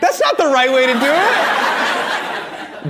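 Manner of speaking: high, drawn-out protest; mock outrage. Real, not acted. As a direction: object slowly in high-pitched disbelief. Mock outrage at audience member.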